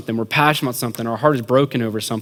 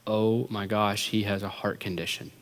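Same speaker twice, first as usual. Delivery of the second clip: hushed dread; 4 semitones lower, 11 dB quieter than usual. Retold or imitated. Friends realising he is ill, hushed and low. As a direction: let it sink in, very quietly. Boys' horrified realisation, retold.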